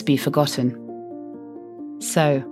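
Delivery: ordinary delivery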